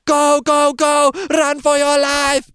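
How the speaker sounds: high, loud, slow mock scream; hammed-up yelling at the fleeing group. [name] screaming at everyone to flee, high loud mock scream. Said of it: "he's still screaming".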